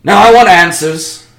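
a commanding official, voiced loud; loud demand. Official demanding answers from the prisoners, loud. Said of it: "And he says".

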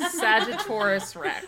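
slow, laughing grand announcement; laughing mock title. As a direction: drawl it out like a royal title.

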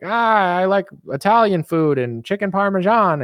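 high, mock Biden voice; squeaky doddering old president; hammed-up Biden; Biden impression. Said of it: "he's like".

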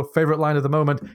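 usual speaking voice

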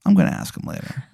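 breathy, unvoiced inner aside; whispered thought. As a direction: whisper it to yourself. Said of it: "you think to yourself".

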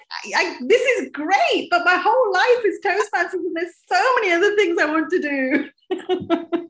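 high, bright mock-exasperated pleading, slowing at the start; playfully acting out being swamped by requests; overwhelmed protest. Toastmasters volunteer telling the club she wants a life, in high mock exasperation. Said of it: "and you're like".

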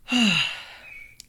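a long, blissful swooning sigh; dreamy sigh. Acted. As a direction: sigh as if lost in rapture. Blissful sigh over uniful.

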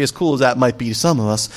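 ordinary talk

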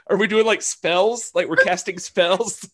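high, laughing question; joking fan. Retold or imitated. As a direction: tease about casting spells. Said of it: "And I'm like".

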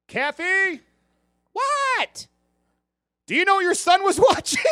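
imitating the father calling his wife from afar; dad calling out. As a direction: call up to the wife, alarmed. Father calls mother, retold.

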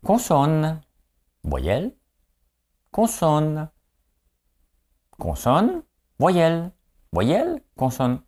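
high-pitched letter calls with pauses; mimicked TV letters game, pitched high; game show contestant impression. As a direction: call out each pick like a quiz contestant. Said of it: "l'émission qui voyait le jour".

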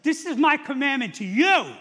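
high, loud declaration of a command; forceful proclamation. Acted. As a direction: proclaim it to everyone at full voice. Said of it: "Jesus said".

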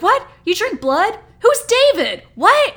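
mock disbelief climbing with each question; incredulous questions. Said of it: "he's just so matter of fact".

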